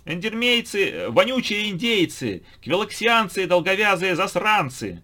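high, loud, slow madman yell; wild unhinged ranting. A narrator's reading. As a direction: scream insults like a raving lunatic. Madman keeps ranting insults.

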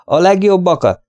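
high-pitched toast. A narrator's reading. Toast back to deaf old man. (emelt hangon koccintva)

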